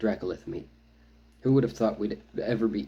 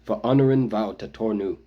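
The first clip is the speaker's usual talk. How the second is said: louder, firm warrior reply; a blunt cousin, firm and louder; firmly; 2 semitones higher, 8 dB louder than usual. A narrator's reading. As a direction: plant the words like a command. Zolesh continues firm reply.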